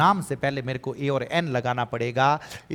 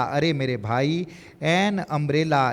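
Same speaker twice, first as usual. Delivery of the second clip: ordinary talk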